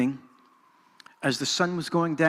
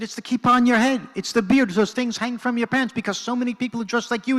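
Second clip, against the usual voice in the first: higher and louder, pressing the point; insistent; 6 semitones higher, 3 dB louder than usual. Retold or imitated. Jamal insisting to Hanan why the kids cry, louder and higher. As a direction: drive it home, almost pleading. Jamal explains the kids' fear.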